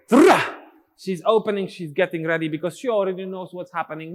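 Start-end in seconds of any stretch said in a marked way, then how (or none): 0.1-0.4 high loud "Tra!"; sharp accent call. Shown as a demonstration. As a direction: snap out "Tra!" on the last beat. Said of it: "On the last beat of this phrase".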